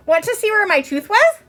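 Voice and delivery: put-on high, bright little-girl voice, same eager question; eager child again. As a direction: ask her friend proudly, rising at the end. Ramona asks again.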